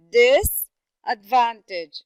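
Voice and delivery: very slow disadvantage, loud stretched DIS; stress on DIS and VAN. Shown as a demonstration. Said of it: "So this, and then we have van".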